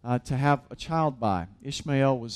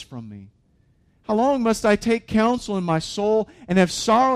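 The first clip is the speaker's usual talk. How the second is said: raised, louder impassioned psalm reading; fervent scripture reading; 5 semitones higher, 5 dB louder than usual. Acted. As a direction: cry out David's lament to God.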